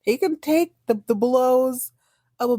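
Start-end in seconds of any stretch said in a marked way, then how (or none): none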